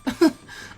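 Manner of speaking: light laugh. A narrator's reading. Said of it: "Tenten let out a laugh".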